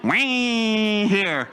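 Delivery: a high, odd-timbred yell from a farmhand; mock farmhand call. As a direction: call out like a country guy behind you, half-joking. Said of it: "guy behind me went".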